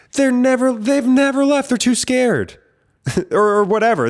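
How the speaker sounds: exasperated, voice climbing high; heated rant. Real, not acted. Ranting about people who never left, high and heated.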